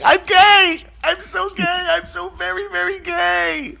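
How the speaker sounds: very high falsetto swoon, slowing on the last words; swooning fanboy. Retold or imitated. Retelling his reaction to [name], lovestruck high falsetto. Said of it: "I was like".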